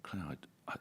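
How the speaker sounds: voiceless, hesitant whisper; hushed reply. Retold or imitated. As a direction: barely breathe the words out. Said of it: "can you imagine what it was like".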